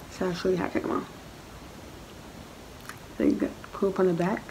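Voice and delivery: slight lisp from trays; wearing retainers. Real, not acted. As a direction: clear aligners on teeth. Seen 2: Clear aligners fitted at -12, worn throughout, removed after +15.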